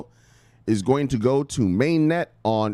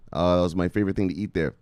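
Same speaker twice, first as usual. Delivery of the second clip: normal talking voice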